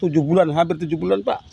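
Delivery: ordinary talk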